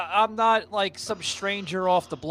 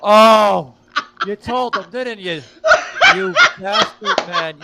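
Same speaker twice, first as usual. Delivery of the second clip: laughing it out at being outed; laughing, caught-out indignation; 5 semitones higher, 11 dB louder, slower than usual. Real, not acted. Laughing at the friend who outed him on air.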